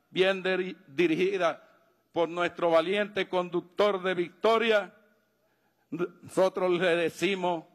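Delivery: usual speaking voice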